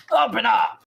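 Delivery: high, very loud shout; yelling through door. Acted. Bandits yelling through the doors and windows, loud shout. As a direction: holler to be let in. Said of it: "You hear yelling through the doors and windows".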